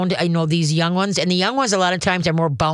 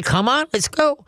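breezy, higher "come on, let's go"; light calling; 9 semitones higher than usual. Shown as a demonstration. Owner calling dog in from doorway, light and higher. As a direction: invite the dog in, easy and light. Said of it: "glance over your shoulder and say".

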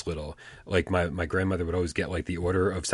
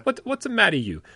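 mock Italian accent; 12 semitones higher than usual. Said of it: "real fucking Italians in my family".

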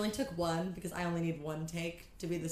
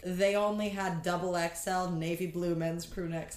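usual speaking voice

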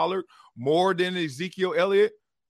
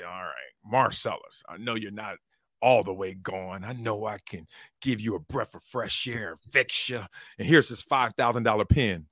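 Jerry Jones impression; 7 semitones lower than usual. Team owner courting a player, low imitating voice. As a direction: sweet-talk him like a deep-voiced team owner. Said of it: "Dallas is sitting there like Jerry Jones".

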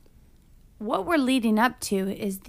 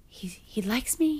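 whispered pep talk; 5 dB quieter, slower than usual. Retold or imitated. Heroine's nervous pep talk quoted.

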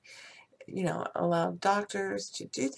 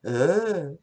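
disgusted ugh; 3 semitones lower, 8 dB louder than usual. Acted. Disgusted groan after laugh.

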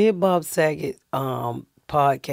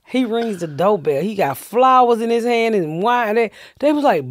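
normal talking voice

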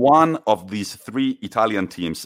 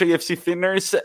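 upbeat redo; 9 semitones higher, faster than usual. Acted. Redoing the thank-you more brightly.

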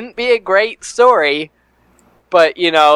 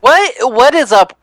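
high, loud disbelief; surprised; 8 semitones higher, 4 dB louder than usual. Real, not acted. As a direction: blurt it out in shock. Hears about potato salad Kickstarter.

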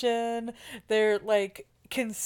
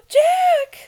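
higher, louder anguished call; mock dramatic cry; 12 semitones higher, 8 dB louder than usual. Claire crying out for Jack, mock dramatic. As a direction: cry out his name like a heroine.

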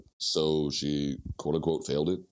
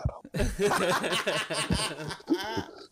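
breathy whispered laugh; 12 semitones higher than usual. Real, not acted.

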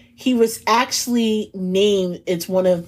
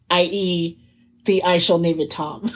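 usual speaking voice

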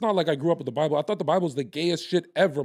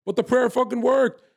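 higher and louder, pressing the point that the prayer worked; real fervour, louder and higher; fired-up insistence; 9 semitones higher, 6 dB louder than usual. Real, not acted. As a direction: hammer it home, voice up high. Insists again.

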